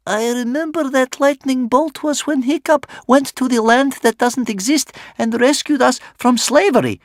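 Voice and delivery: put-on very high, loud little child voice; Bear Cub. A narrator's reading. Little wanderer at back of crowd, calling out high. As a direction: call out as a small child. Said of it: "called out a little wanderer named Bear Cub".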